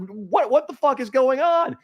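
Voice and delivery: high, baffled "what the fuck"; confused outburst. Retold or imitated. Waking to angry texts, a high baffled outburst. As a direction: sound totally lost and startled. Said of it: "And I'm like".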